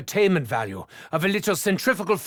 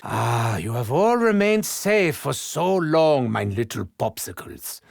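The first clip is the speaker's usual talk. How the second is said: 1 creepy tender coo; 8 semitones lower than usual. Acted. Cooing to frozen subjects.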